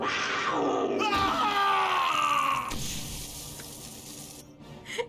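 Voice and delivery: high, loud burst of laughter. Real, not acted. Laughing hard as ally Linu dies in the doorway, loud. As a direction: cackle out loud at the ally's death.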